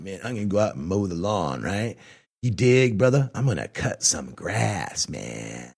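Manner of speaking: mock stoner voice. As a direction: talk like a mellow hippie dude. Imagined stoner slang speech.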